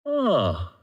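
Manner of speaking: light up with giddy discovery; delighted revelation. Retold or imitated. Runner retells feeling high after his first run, giddy delight.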